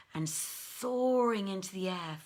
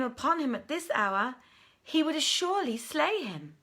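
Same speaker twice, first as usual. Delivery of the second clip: raised, louder voice of the jinniya in wonder; a spirit marvelling aloud, rising in force; awed jinniya; 5 semitones higher, 4 dB louder than usual. Acted. Jinniya voiced musing over youth.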